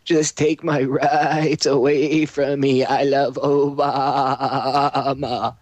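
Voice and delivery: slow, dopey mock of a gullible voter; mocking sheeple voice. Host mocks gullible voters with a slow, dopey voice. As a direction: drawl it out like a brainwashed follower. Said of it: "That's what they're like right now".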